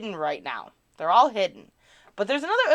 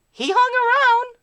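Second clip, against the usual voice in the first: pitched up, mock squeak; high silly voice; 7 dB louder than usual. Streamer repeating a line in a squeaky joke voice.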